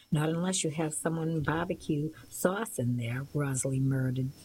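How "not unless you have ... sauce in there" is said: ordinary delivery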